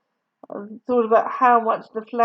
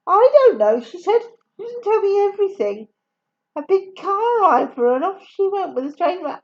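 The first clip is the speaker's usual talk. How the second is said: put-on fibbing child; 9 semitones higher, 3 dB louder than usual. Katie fibbing to caller, high.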